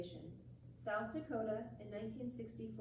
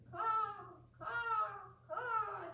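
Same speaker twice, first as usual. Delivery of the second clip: high cawing cries; crow crying; 9 semitones higher, much slower than usual. Acted. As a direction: caw out in distress. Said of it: "he cried".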